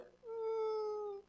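high, quiet skeptical mm; a smug unimpressed hum; dismissive hum. Retold or imitated. Proud listener judging the sermon, high skeptical hum.

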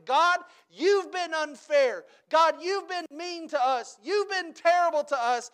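high, loud whiny grievance; mock complaint. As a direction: whine at God like spoiled children. People whine at God.

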